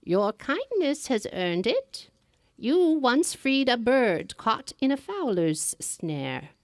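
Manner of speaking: put-on a kindly but eerie old crone, drawn out; old woman in green. Old woman gives charm.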